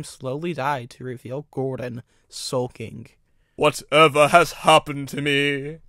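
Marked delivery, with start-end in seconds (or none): 3.6-5.8 loud, slow lament; crying out. A narrator's reading. Big engine bewildered after breaking down, loud slow cry. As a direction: wail it out slowly and loudly. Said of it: "cried the big engine".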